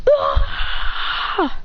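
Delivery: high cry on being struck; long high dying yell; death scream. Acted. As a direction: scream high as the blast hits. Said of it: "As he goes".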